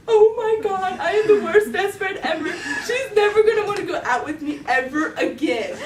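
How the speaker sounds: high, loud comic panic; freaking out. Retold or imitated. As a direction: wail it in hammed-up despair. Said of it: "So I was freaking out".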